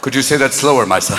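low, level priestly request; grave Father in a drone; confessional priest. Acted. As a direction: ask it low, with mock solemnity.

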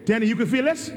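loud, rising cry of his son's name; calling out. Retold or imitated. Father calling to his son during the X-ray, shouting. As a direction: shout "Denny!" to rouse him.

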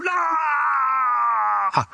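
long, drawn-out wail that falls away; possessed man's outcry. Acted. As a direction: wail it slowly like a man possessed. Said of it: "all of a sudden this guy goes".

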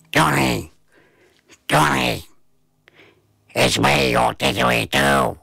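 gruff talking didgeridoo. A narrator's reading. Didgeridoo revealing itself to the boy, gruff. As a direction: put on a gruff creature voice. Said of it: "gruff voice".

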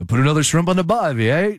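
half-Dracula, half-Australian attempt; bad Australian accent. Shown as a demonstration. Host asked about accents, has a go at bad Australian. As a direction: Outback Steakhouse style Australian accent. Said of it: "try and do an Australian accent".

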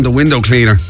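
ordinary delivery

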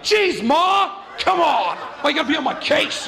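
high whiny shout at mom; bratty kid yell. Retold or imitated. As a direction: yell back like a bratty twelve-year-old.

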